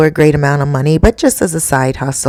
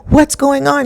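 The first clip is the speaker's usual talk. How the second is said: higher-pitched alarmed question; retold alarm; 8 semitones higher than usual. Boyfriend finding girlfriend screaming by the pool, retold alarm. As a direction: act out his startled question, pitched up.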